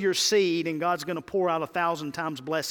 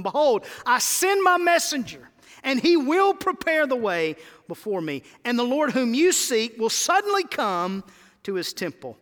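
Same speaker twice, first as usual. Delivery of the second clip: higher, louder proclaiming read; preaching scripture; 6 semitones higher, 5 dB louder than usual. Acted. Preacher reading Malachi, a loud proclaiming read. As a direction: proclaim it to the church. Reads scripture at full force.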